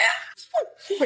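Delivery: very high laughing squeal; overwhelmed happy shriek; squeal of delight. Real, not acted. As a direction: squeal like you can't take it. Squealing over the scene.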